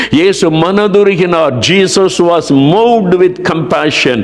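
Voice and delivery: high, urgent preacher's delivery; impassioned preaching. Acted. Preacher telling how Jesus was moved by the widow, impassioned. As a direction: preach it with rising fervour.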